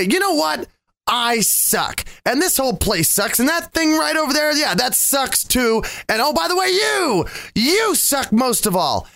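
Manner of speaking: high, hammed-up complaint building to YOU; comically fed-up tirade; mock grumpy rant. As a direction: escalate the griping, peaking high on "you". Acted grumpy answer to housemates.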